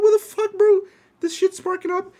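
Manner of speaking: high, loud alarmed shout; alarmed yell. Retold or imitated. Quoting himself as the vent sparks, high alarmed shout.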